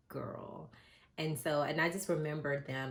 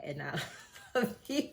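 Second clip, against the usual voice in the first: higher, laughing through the words; 6 semitones higher than usual. Real, not acted. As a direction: crack up as you read it. Reading brother's note, laughing.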